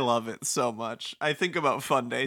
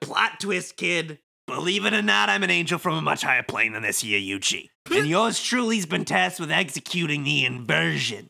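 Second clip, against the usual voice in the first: breezy, mocking wiseguy; sly villain Kubo; 3 semitones higher than usual. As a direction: thank them with smug false gratitude. Plays Kubo reading game script.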